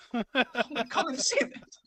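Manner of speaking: hammed-up eavesdropper waving a friend over, rising into a laugh; lightly voiced Heyman, half laughing; mock Paul Heyman. Voicing Paul Heyman peeking through door.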